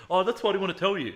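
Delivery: high, loud mock protest; flustered sonographer. Sonographer to patient after revealing the tumor, flustered.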